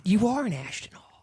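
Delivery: high-pitched teasing exclamation; amazed. Real, not acted. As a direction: squeak it out in mock disbelief.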